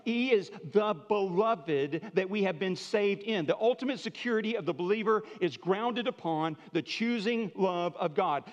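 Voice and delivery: ordinary delivery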